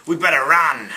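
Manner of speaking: put-on lightly done Australian accent calling to run; mock Australian accent. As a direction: play a jokey Australian soldier shouting to flee. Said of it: "because this is a great accent".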